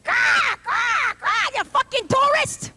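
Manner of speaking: high squawking caw, then a rude yell; rude pigeon. Retold or imitated. New York pigeon cursing a tourist, squawking high. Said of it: "They're like".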